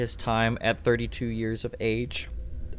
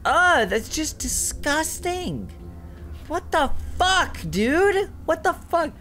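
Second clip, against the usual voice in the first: much higher, slow, disgusted and scared; real horrified shriek; 14 semitones higher, slower than usual. Real, not acted. Facing the skinless monster in the game, high horrified shrieking. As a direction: recoil from something grotesque.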